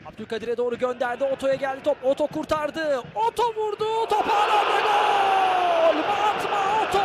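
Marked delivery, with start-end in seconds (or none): 3.2-7.0 screaming football commentator. Real, not acted. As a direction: rise high and drag out the goal. Goal call in live commentary. (tiz, heyecanlı gol çığlığı)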